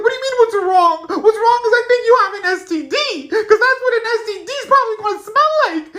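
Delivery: high, loud, laughing disbelief; cracking up while accusing him; laughing accusation. Retold or imitated. Accusing his crush in the car, high laughing disbelief.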